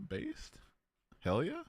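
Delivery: tiny high squeak; squeaky based. Real, not acted. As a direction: squeal the word. Gleeful reaction.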